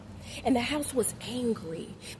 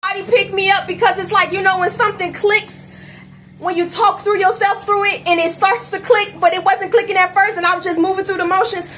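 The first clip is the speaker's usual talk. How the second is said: higher, louder venting; exasperated raised voice; worked up; 7 semitones higher, 10 dB louder than usual. Real, not acted. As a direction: rant it like you need rescue.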